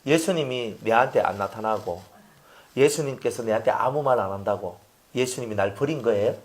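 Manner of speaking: normal talking voice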